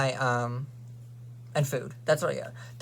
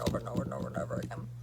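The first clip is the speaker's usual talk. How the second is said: rapid whisper; 4 semitones lower, 8 dB quieter, faster than usual. Real, not acted. Repeating over and over.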